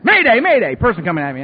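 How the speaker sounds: high, loud mock distress call; hammed-up inner panic; panicked alarm. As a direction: sound the alarm in your head. Panic inside his head.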